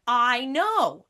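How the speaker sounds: loud, high, drawn-out exclamation; emphatic, stretched-out shout of agreement; excited agreement. Retold or imitated. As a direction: burst out with thrilled recognition. Excitedly replying to texted revelation.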